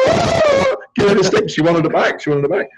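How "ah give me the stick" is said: a very high ah, then a pleading cry; imitated desperate grab. Therapist imitating his client crying out for the stick. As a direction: play her snatching for the stick. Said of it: "And she goes".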